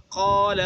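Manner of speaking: قال with a full, heavy alif, slowly. Shown as a demonstration. Teacher demonstrating heavy alif in قال. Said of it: "الف جو پر پڑھا جاتا ہے".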